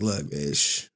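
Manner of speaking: high voice cracking with laughter; laughing mock seller. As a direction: laugh through the sales pitch. Seller mocking crying fan.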